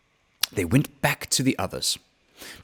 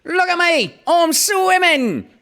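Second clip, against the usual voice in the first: very high, loud squeaky little joey with Australian twang; squeaky Roo; 4 dB louder, slower than usual. A narrator's reading. Roo squeaks proudly to everyone from the pool, very high. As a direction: squeak it out like an excited little kangaroo.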